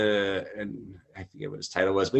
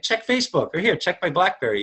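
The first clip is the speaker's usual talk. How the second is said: insistent quote; 8 semitones higher than usual. Retold or imitated. Insisting to disbelieving listener.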